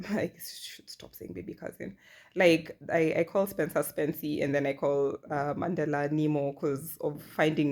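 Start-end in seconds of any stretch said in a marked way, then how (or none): 0.1-2.0 whispered aside. Real, not acted. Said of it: "aside to herself".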